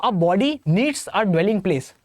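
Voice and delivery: very slow, word by word; slow mnemonic. Shown as a demonstration. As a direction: spell it out slowly for students. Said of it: "abode को ऐसे याद रखो". (धीरे-धीरे एक-एक शब्द पर ज़ोर)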